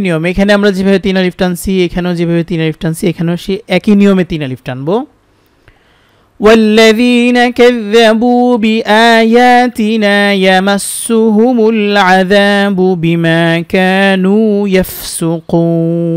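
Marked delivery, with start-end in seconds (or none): 6.4-16.1 slow, melodic tajweed chant with long vowels; Quran recitation. Acted. Teacher recites the whole verse for students in a slow chant. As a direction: draw each vowel out in a measured recitation.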